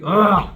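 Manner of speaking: short loud angry grunt. Shown as a demonstration. Vocal coach demoing the raw sound anger makes. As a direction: grunt like you're mad. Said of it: "So I'm angry".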